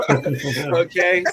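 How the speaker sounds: ordinary talk, nothing marked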